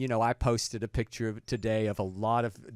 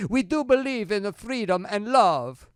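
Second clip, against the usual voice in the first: mock Italian; 8 semitones higher, 6 dB louder than usual. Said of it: "Now, continuing".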